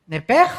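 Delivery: loud "nepej" with a harsh final j; Hebrew guttural. Shown as a demonstration. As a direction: punch out "nepej" and its throaty end. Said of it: "se pronuncia nepej".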